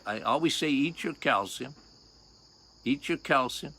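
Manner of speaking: usual speaking voice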